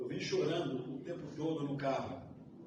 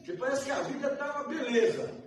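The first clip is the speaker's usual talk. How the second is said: complaining believer's prayer; 7 semitones higher, 3 dB louder than usual. Retold or imitated. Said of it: "A gente pergunta".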